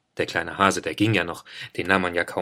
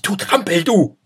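high, loud indignant little hare voice; squeaky scolding; 10 semitones higher, 6 dB louder than usual. A narrator's reading. Hare scolds clumsy bear, shrill and loud. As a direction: scold him like an outraged tiny hare.

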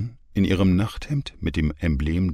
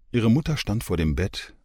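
ordinary delivery

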